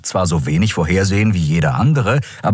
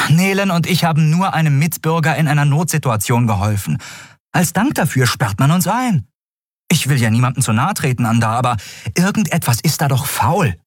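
higher-pitched, wronged complaint; aggrieved protest; 8 semitones higher than usual. A narrator's reading. Prisoner locked up for helping a citizen, aggrieved. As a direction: protest the unfair treatment.

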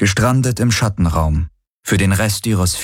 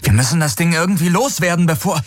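curses rising into high urgency; frantic cursing; 9 semitones higher than usual. A narrator's reading. Galen urges getting rid of the beast, frantic. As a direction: start under your breath, climb to high-pitched alarm.

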